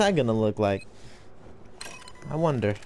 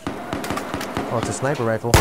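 normal talking voice

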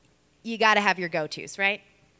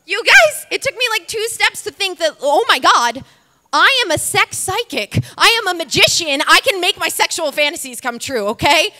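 much higher and louder, breathless thrill; giddy excitement; 8 semitones higher, 7 dB louder than usual. Real, not acted. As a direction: blurt it out like you just won the lottery. Thinks her dream comes true.

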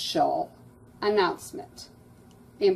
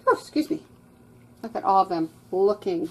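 ordinary delivery, nothing marked

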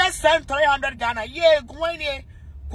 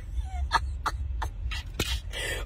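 high squeaky laugh; 9 dB quieter than usual. Real, not acted. Squeaky laugh after mock cry.

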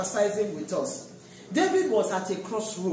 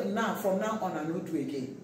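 defeated quitter; 4 semitones lower, 7 dB quieter than usual. Retold or imitated. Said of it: "that's some of our attitude".

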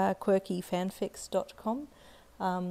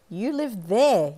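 slow, high, louder pouty insistence; pouting child; 4 semitones higher, 7 dB louder, much slower than usual. Acted. Little girl tells the boy where he lives, slow pout. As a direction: pout it out slowly like a bossy kid. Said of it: "you pouted".

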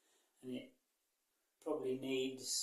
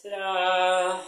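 loud, bright showman reveal; 4 semitones higher, 10 dB louder than usual. Real, not acted. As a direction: present the room like a magic trick. Reveals painted room.

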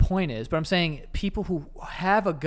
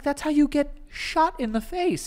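incredulous warning; 9 semitones higher, slower than usual. Retold or imitated. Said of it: "I'm like".

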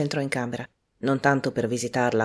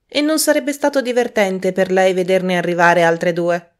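higher and louder, teasing woman; teasing Prudence; 6 semitones higher, 9 dB louder than usual. A narrator's reading. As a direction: needle him with a cheeky jab. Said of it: "Mentre Prudence pronunciava la frase".

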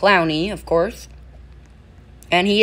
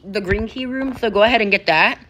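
ordinary talk, nothing marked

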